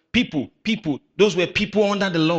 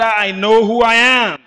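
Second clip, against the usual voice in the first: louder and slower, higher; preacher leads a louder round; 7 semitones higher, 9 dB louder than usual. Shown as a demonstration. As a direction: push it louder.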